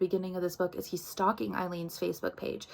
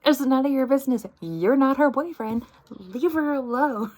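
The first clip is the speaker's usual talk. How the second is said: higher, loud and fast, snapping back; angry snap; 5 semitones higher, 8 dB louder than usual. Retold or imitated. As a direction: bite his head off, loud and quick. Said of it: "like, snaps".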